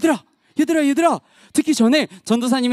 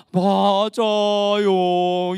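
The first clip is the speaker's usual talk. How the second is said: very drawn-out, low confirmation; slow agreement; 6 semitones lower, much slower than usual. Real, not acted. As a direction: stretch each syllable low and slowly. Answering children with slow agreement.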